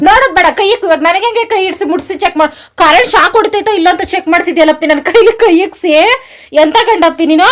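high, loud voice of an aggrieved wife; indignant scolding. Real, not acted. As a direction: tell your husband off loudly. Wife scolds husband over shock.

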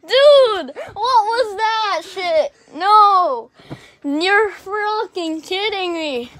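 high, drawn-out wail of dismay; real dismayed outburst. Real, not acted. Player wailing at friend's blunder in the race, dismayed. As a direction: cry out in real disbelief.

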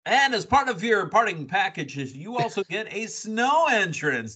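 high, sing-song prize announcer voice; playful, hammed-up announcer patter; mock announcer. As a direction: present it like a game-show parting gift. Mock prize announcement for debuting wrestlers.

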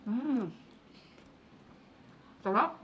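chewing while talking; muffled by food; mouth full. Real, not acted. Seen 0: Bites burger at -1.0, then chews with full cheeks throughout.

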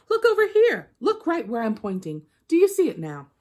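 high and loud, frustrated pointing; loud exasperated trickster voice; angry Anansi. Acted. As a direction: demand she look, getting cross. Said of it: "Anansi began to get angry".

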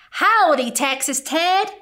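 put-on Cowboy Baby greeting his toy bear, eager. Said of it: "said Cowboy Baby".

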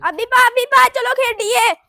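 loud call to a friend outside. Acted. Friend calls Abhi loudly from outside to play. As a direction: shout his name across the yard to come and play.

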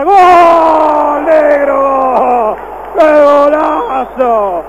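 shouted goal call, stretched out; breakneck goal commentary. Real, not acted. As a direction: yell the goal at full voice. Goal call after Fabra's shot.

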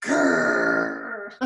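a bear's grumbly growl. Acted. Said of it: "and bear growls".

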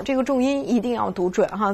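normal talking voice

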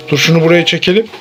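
ordinary talk

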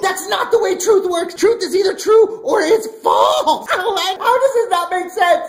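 high, loud, half-laughing exasperation; exasperated yell. Acted. Pastor yelling to kids that truth is true, exasperated. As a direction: shout it like you cannot believe it.

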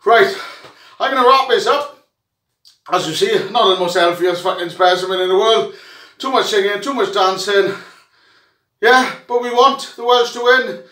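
ordinary talk, nothing marked

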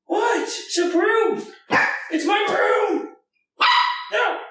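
very high pet-talk pitch; high dog-talk voice. Real, not acted. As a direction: squeak it up for the dog.

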